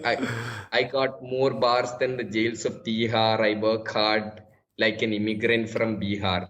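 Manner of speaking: slow, deliberate Indian-accented delivery; demonstrative Indian accent, unhurried. Shown as a demonstration. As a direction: spell it out syllable by syllable in an Indian accent. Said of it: "say that in an Indian accent".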